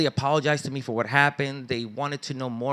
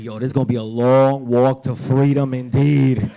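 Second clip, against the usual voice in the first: mock dismay; 8 dB louder than usual. Reader facing a long book, mock dismay. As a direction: proclaim it loudly, playing up the dread. Jokes about the daunting book.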